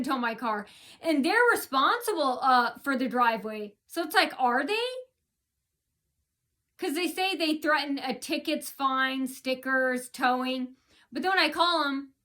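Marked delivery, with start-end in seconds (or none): none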